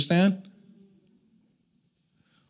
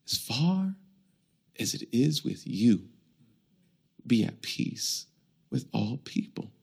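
hushed scripture; 4 semitones lower, 8 dB quieter than usual. Acted. Quoting scripture softly.

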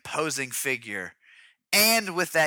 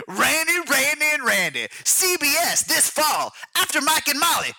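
mock TV promo announcer; 11 semitones higher, 4 dB louder than usual. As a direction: sell the new show with big energy.

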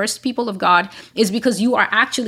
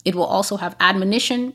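ordinary delivery

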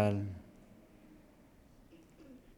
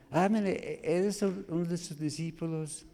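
higher, light mock voice of a girl; accusing girl; 6 semitones higher than usual. Servant girl questioning Peter, high mock voice. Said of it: "Llegó otra muchacha".

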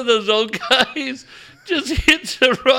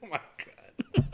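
ordinary talk

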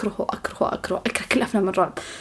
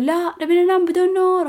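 acted protest; 9 semitones higher than usual. Sisters refusing to let her switch the lamp on, high mock protest. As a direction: imitate stubborn sisters refusing, pitched way up.

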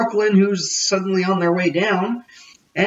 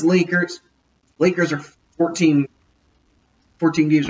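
normal talking voice

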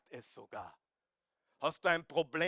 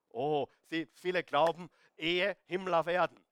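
normal talking voice

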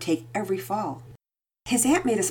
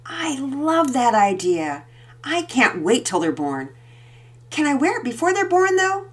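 put-on slow, higher, hopeful child's voice; a girl pleading sweetly; eager little girl; 8 semitones higher, slower than usual. A narrator's reading. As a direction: daughter asking her mom.

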